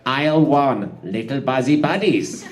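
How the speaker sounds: ordinary delivery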